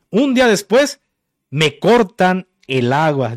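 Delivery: ordinary talk, nothing marked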